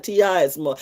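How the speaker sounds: ordinary talk, nothing marked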